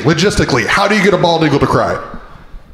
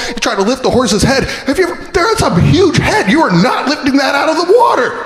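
ordinary talk, nothing marked

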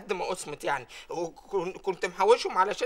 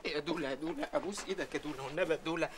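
soft begging; 6 semitones lower, 7 dB quieter, faster than usual. Acted. Employee begging his boss.

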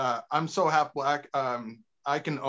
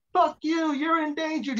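much higher, put-on voice; mock bald eagle taunt; 12 semitones higher, 3 dB louder, slower than usual. The eagle taunts the person below, in a high mocking voice. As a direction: squawk it like a cocky bird. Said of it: "You're like".

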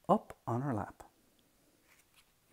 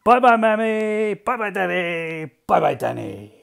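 calling goodbye; 8 semitones higher, 13 dB louder than usual. Acted. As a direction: shout cheery goodbyes from a departing car. Hal calls goodbye rushing out.

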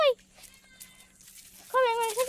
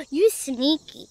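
gasp, then a slow, louder you sneaky; mock-shocked gasp; 5 dB louder, slower than usual. Real, not acted. Catching the horse nibbling her pocket, mock shock. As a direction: playfully scold the sneaky horse.

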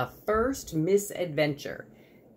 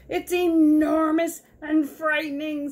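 high, loud squirrel wail; frightened squirrel; 13 semitones higher, 8 dB louder than usual. Acted. Squirrel trying again for Sugar, frightened. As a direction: swell loudly on a high, alarmed pitch. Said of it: "said Squirrel".

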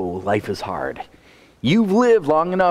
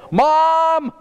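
put-on child's loud call, much higher and louder; retold yell; 12 semitones higher, 4 dB louder than usual. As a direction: shout for mom down the hall. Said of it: "He yelled as loud as he could down the hall".

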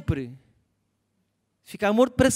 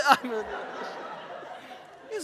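breathless laugh; 3 semitones higher, 5 dB quieter, much slower than usual. Real, not acted. Laughing at himself.

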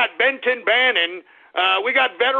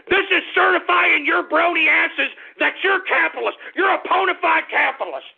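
excited hype shout; 8 semitones higher than usual. Real, not acted. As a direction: pitch the merch like a carnival barker. Hyping his merch to fans.

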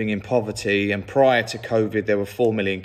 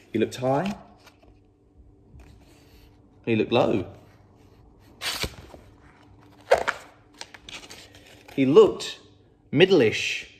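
lively, higher storybook voice; reading to children; 7 semitones higher, slower than usual. A narrator's reading. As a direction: read it like a bedtime picture book.

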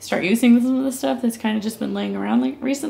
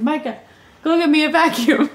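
asking through laughter, a bit higher; laughing plea; 7 semitones higher than usual. Retold or imitated. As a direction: giggle while begging for help. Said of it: "And I said".

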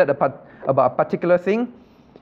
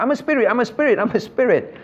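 insistent, higher-pitched repeats of "I'm a spirit"; emphatic repetition; 7 semitones higher than usual. Acted. Teacher voices God repeating himself to the class, emphatic. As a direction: hammer "I'm a spirit" over and over, raised pitch. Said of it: "Why he keep emphasizing".

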